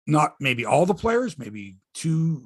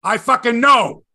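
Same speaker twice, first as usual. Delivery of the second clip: louder, slow, fed-up snap; exasperated outburst; 7 semitones higher, 10 dB louder than usual. Real, not acted. Fed up with Mike's whining, louder angry burst. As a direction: blow up at the co-host, drawn out. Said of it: "You're getting me very aggravated with this".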